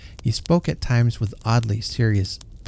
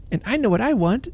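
put-on eager little boy; 12 semitones higher than usual. A narrator's reading. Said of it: "said the boy".

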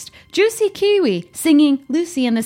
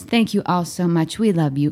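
ordinary delivery

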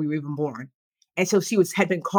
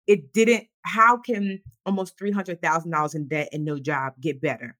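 ordinary delivery

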